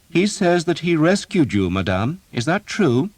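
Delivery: put-on young nobleman. A narrator's reading. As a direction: question her like a sure young lord. Unseen young nobleman questions.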